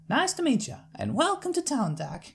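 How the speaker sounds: friendly big-sister country voice; Applejack's country accent. Acted. Applejack sending her sister to the farm, Southern accent.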